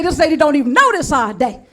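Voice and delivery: ordinary talk, nothing marked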